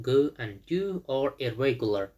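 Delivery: reads each word slowly for learners; slow, deliberate classroom reading; slow careful reading. Shown as a demonstration. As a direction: spell it out slowly like a teacher. Teacher reads textbook line.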